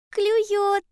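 high, loud hedgehog shout; excited cry. A narrator's reading. Hedgehog alerting bear cub to a bite, excited cry. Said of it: "крикнул ёжик".

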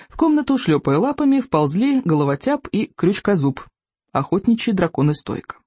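ordinary delivery, nothing marked

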